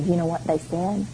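a hint of an ominous preacher, low and soft; lightly mocked doom-laden preacher; scary preacher voice. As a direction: imitate a pastor spooking his table. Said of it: "in his best scary preacher's voice".

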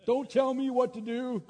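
high, loud mock yell; dad's defiant yell, acted out; imitated yell. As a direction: yell it in protest, imitating an angry patient. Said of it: "he's yelling at me".

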